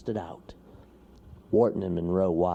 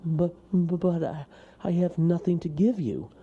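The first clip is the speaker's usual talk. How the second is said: frightened toad stammer; 10 semitones higher, 4 dB louder than usual. Acted. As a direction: stumble the words out, scared. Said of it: "stammered Wharton".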